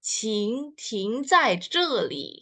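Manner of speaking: "Qing ting zai zhe li." said slowly, word by word; slow Mandarin. Shown as a demonstration. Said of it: "cara bacanya". (pelafalan Mandarin pelan)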